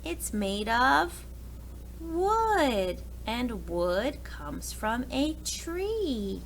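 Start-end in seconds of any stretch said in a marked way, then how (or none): none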